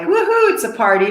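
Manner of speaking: mock cheer at a party; hint of party excitement; excited party cheer. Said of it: "a word that we know is like".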